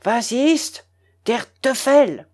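slow, emphatic German outrage; indignant anger. A narrator's reading. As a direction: spit out the words, affronted. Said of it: "me regarda avec colère". (colère indignée, mots martelés)

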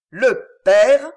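Le père punched, loud and high; insisting. A narrator's reading. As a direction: lean hard on Le père. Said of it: "il insistait sur ces deux mots".

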